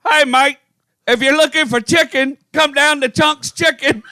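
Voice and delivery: high, hammed-up English ad read; bad English accent. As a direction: sell it in a terrible English accent. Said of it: "I can't do accents".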